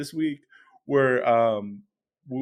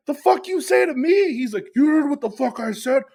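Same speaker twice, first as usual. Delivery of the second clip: much higher, louder mock roar; roaring back; 12 semitones higher, 3 dB louder than usual. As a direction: roar back like a young lion. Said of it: "roars back like a young lion".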